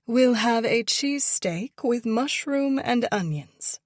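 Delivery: mock Victorian grandeur, ordering for him; fake Victorian accent. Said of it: "in a fake Victorian accent".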